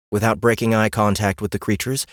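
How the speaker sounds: ordinary delivery, nothing marked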